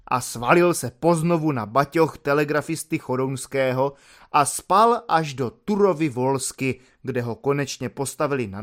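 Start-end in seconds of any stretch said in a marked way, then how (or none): none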